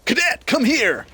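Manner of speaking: high, loud parade-ground call to the cadet; a general shouting for his cadet; barked order. Acted. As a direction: yell it across the camp like a general. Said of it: "And he goes".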